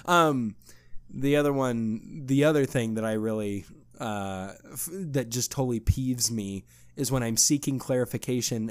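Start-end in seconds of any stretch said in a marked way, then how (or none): none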